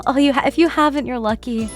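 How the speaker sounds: ordinary delivery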